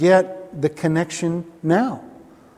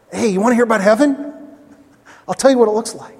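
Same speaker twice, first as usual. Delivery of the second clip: brisk, higher, eager pitch to hear about heaven; eager evangelist; 5 semitones higher, faster than usual. Retold or imitated. Preacher voicing a keen evangelist inviting people to heaven, eager. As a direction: sell heaven like a keen street preacher, quickly. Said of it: "You know, what an evangelist".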